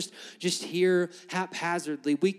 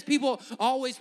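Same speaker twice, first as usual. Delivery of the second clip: ordinary talk, nothing marked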